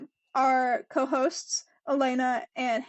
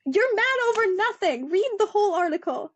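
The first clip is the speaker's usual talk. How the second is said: higher-pitched mock telling-off; exasperated scolding; 7 semitones higher than usual. Podcaster addressing fans who misread a clipped article, exasperated.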